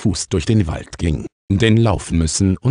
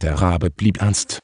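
usual speaking voice

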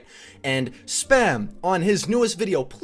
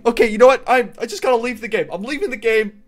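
exasperated outburst; 9 semitones higher, 8 dB louder, faster than usual. Real, not acted. Rage quitting the game.

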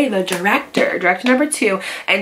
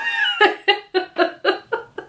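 high burst of laughter; 12 semitones higher than usual. Real, not acted. Laughing at the director firing Aria, a high real laugh.

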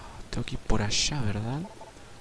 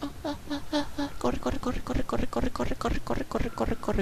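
high, rapid panicked chant; high-pitched fleeing panic, held in; panicked urging; 13 semitones higher, 5 dB quieter than usual. Real, not acted. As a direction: squeak it over and over as you flee. Urges self to run from enemy.

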